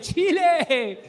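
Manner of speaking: high, loud shouted list, slowing at the end; stadium roll call. Retold or imitated. [name] shouting out countries to the crowd, high loud roll call. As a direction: hype up each country like an MC.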